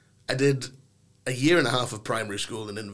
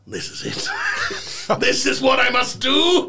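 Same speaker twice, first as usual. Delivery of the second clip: triumphant shout; 12 semitones higher, 6 dB louder than usual. Retold or imitated. Boy's triumphant realisation on stage.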